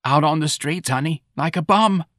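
high, plaintive, resigned answer; lamenting confirmation. Acted. Mrs. Riley confirming it to Santa, plaintive. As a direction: confirm the shame to a friend. Mother confirms the shameful news.

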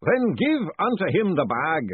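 slow, booming ritual master. A narrator's reading. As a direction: boom it out like a grand master of ceremonies. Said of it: "boomed the distant voice".

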